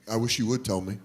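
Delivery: low, soft, gentle fatherly voice; tender, hushed sincerity; dad-like concern. Retold or imitated. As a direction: imitate a caring dad, softly, with long pauses. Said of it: "I'll just dad on this kid".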